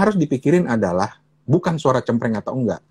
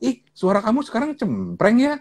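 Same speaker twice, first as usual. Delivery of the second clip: mock acquaintance remark; 11 semitones higher than usual. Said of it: "ketemu orang terus bilang".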